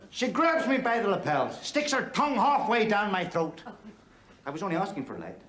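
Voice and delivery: ordinary delivery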